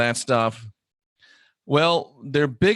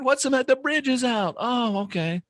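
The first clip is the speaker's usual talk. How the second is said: imitated driver; 11 semitones higher than usual. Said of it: "if the bridge was out".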